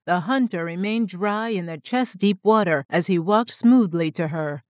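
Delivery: ordinary delivery, nothing marked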